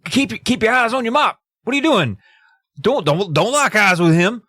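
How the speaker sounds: panicky, pleading with Andy to look away; frantic Red warning. Retold or imitated. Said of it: "Red's like".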